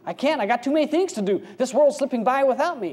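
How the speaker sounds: high, hurried complaining; hammed-up impatient excuse; mock protest. Acted pupil protesting the orders.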